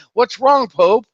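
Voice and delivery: high, loud mock alarm; hammed-up panicked question; freaking out. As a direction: cry out as if rushing in worried. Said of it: "they're all freaking out".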